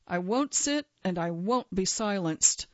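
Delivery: ordinary delivery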